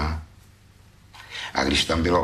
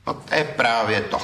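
firmly; 6 semitones higher than usual. Acted. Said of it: "řekl jsem tvrdě".

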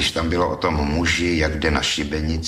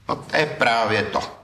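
firm, slightly raised; 6 semitones higher than usual. Acted. Critic firmly rejecting the thief's poems. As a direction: cut him off firmly. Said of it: "řekl jsem tvrdě".

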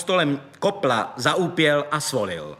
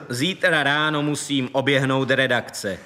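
plain reading voice

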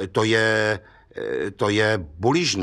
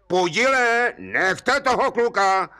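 called out high across the water; calling across the river; 8 semitones higher, slower than usual. Retold or imitated. Stranger calls across the river to Pudil, mock call. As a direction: hail him from the far bank. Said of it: "na něj někdo z druhého břehu volá".

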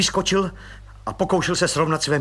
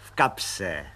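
grinning reply; 8 semitones lower, 5 dB quieter than usual. Acted. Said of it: "vycenil zuby pan Pištora".

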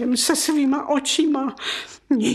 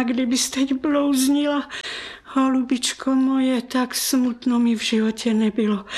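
usual speaking voice

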